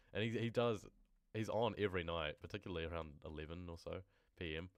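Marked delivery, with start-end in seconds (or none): none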